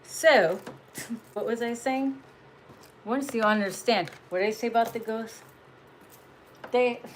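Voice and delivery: ordinary talk, nothing marked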